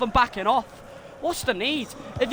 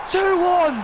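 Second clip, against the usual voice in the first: higher and louder; triumphant shout; 8 semitones higher, 6 dB louder than usual. Real, not acted. Fan celebrating his team going ahead, triumphant shout. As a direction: punch out the score in joy.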